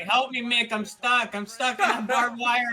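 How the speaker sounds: hammed-up distress call, laughter creeping in; pleading wrestler. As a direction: imitate a stuck wrestler begging for rescue. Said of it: "he's like".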